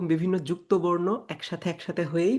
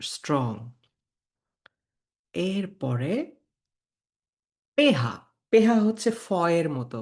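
ordinary delivery

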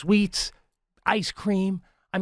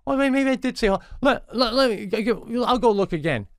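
mock flustered doctor; 10 semitones higher than usual. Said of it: "And he goes".

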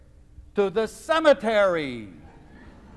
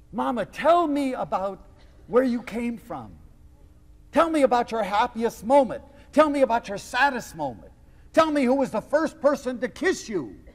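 lightly acted, higher-pitched urging; a grown child's eager, insistent questions; earnest plea; 6 semitones higher than usual. Child asking Mama about her life, earnest plea.

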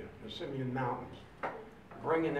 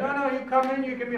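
louder and higher, pressing "no, no"; insisting officer; 11 semitones higher, 11 dB louder, faster than usual. Retold or imitated. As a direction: keep pushing him to come in.

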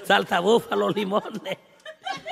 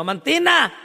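calling out; 8 semitones higher, 6 dB louder, slower than usual. Retold or imitated. Calling the owner again.

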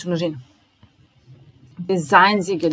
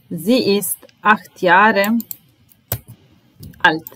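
slowed down so learners can follow; careful slow read; slow repetition; slower than usual. Shown as a demonstration. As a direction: draw each word out for the class. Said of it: "teacher repeats model sentence slowly".

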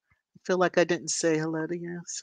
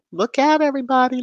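excited call; 8 semitones higher, 7 dB louder, faster than usual. Real, not acted. Singsong call to a walking baby.

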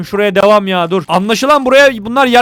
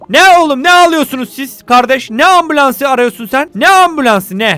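loud, higher, exasperated shout at the townsfolk; really fed up, bellowing at the crowd; angry yelling; 3 semitones higher, 4 dB louder than usual. Real, not acted. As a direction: scold them like a fed-up mayor. Yelling at crying citizens.

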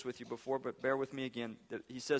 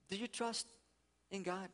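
softer, higher, intimate question to the congregation; 4 semitones higher, 7 dB quieter than usual. Real, not acted. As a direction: ask it gently, almost privately.

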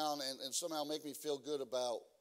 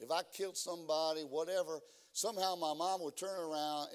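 usual speaking voice

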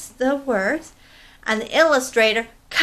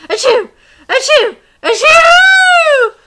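three sneezes climbing higher and louder; big sneezes; 11 dB louder, slower than usual. Acted. Monkey sneezing at the blue feather, building sneezes. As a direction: sneeze hard, each one bigger. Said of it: "began to sneeze".

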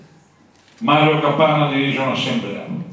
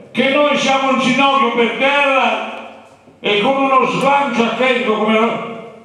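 rally orator; 13 semitones higher, 3 dB louder than usual. Retold or imitated. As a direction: lay out the enemies to the crowd. Capanna addresses the assembly. (oratore da assemblea, lento e forte)

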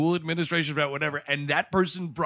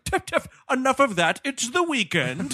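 mock dismissive dean; 9 semitones higher than usual. Dean brushes her off.

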